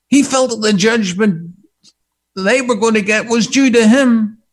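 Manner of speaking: loud, emphatic pulpit delivery; forceful preaching. Real, not acted. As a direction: drive each phrase home to the congregation.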